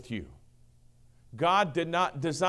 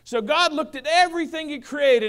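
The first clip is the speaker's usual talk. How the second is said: normal talking voice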